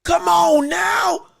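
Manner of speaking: slow, high, loud pleading wail; crying come on now, slow and pained; anguished cry. Real, not acted. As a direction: cry out in despair at the screen. Anguished plea at the fight.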